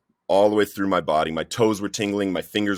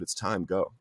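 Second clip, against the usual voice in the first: quiet, calm repeat, imitating a gentle inner voice; lowered voice for a retold whisper; soft whisper; 8 dB quieter than usual. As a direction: say it softly and simply, unmoved by the outburst. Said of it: "he just repeats himself".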